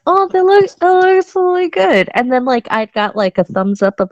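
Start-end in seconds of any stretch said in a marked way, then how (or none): 0.1-2.1 high-pitched, delighted approval; admiring self-quote. Retold or imitated. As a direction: act out gushing over your own video. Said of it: "I'm like".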